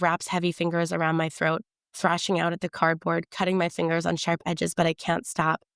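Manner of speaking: ordinary delivery, nothing marked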